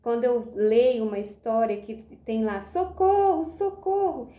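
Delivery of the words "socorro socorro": high-pitched storybook cry for help; pedido de socorro. Pretend. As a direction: call for help in mock alarm. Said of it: "uma frase que me chama a atenção". (grito de socorro encenado, bem agudo)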